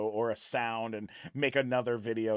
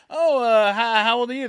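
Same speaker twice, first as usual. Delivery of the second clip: mock polite; 10 semitones higher, 10 dB louder than usual. Mocking himself at teen party.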